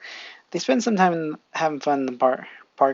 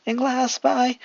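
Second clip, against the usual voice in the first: blushing; 8 semitones higher, slower than usual. Acted. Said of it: "blushing, says".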